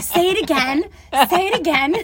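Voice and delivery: high, loud mock pleading; eager egging-on. Coworkers goading her to show off her accent, eager. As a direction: imitate delighted listeners begging for a repeat. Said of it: "just say stuff to get me to pick it up".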